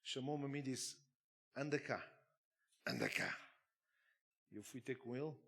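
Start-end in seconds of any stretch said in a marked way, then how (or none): 1.5-3.4 summoning, dropping very deep on the repeat; thick demonic voice. Retold or imitated. The demon summons the pastor with a deep thick voice. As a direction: call him over in a sinister low voice. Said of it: "ele disse com uma voz grossa".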